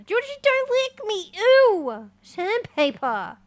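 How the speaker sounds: high, loud squeal of disgust; squealing at pet. Real, not acted. Streamer squealing at Georgie licking her face. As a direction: squeal as the tongue scrapes.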